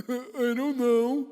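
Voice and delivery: loud, high, drawn-out mock I-don't-know; exaggerated dopey shrug. Husband answering his wife about his lost voice, hammed-up cluelessness. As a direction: ham up a slow, clueless shrug. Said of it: "She just mocked me right back".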